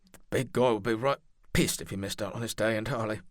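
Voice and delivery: a quick quip with a slight catch in the throat; faintly breaking voice under a light joke; voice breaking slightly. Acted. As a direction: joke it off while holding back grief. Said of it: "his voice breaking ever so slightly".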